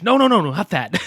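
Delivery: mock protest. Acted quote of Doug protesting.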